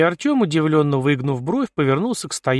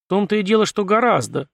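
higher-pitched, firm confirmation; decisive; 7 semitones higher than usual. A narrator's reading. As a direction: confirm it with conviction. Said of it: "решительно кивнул Искин". (решительно подтверждая)

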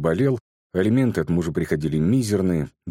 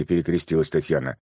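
plain reading voice

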